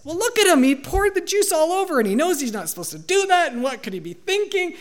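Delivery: high-pitched, exasperated protest; heated self-justification. Retold or imitated. Husband justifying yelling at their son to his wife, heated.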